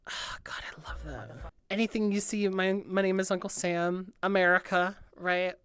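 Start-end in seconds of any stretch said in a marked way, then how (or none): 0.1-1.5 real, very soft whisper; awed whisper. Real, not acted. Listener loving the song's idea, awed whisper. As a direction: whisper it to yourself, savouring it. Savouring the song.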